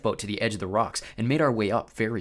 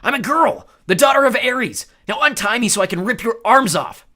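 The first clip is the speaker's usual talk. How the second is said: loud, high insult; furious yell; 11 semitones higher, 11 dB louder than usual. Acted. Clarice insulting the Cyclops, loud yell. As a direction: spit the insult up at him.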